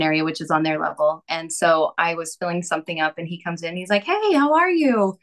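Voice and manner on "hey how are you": high, slow and louder, sing-song; friendly guy greeting. Retold or imitated. New tenant greeting her in the kitchen, cheerful imitated hello.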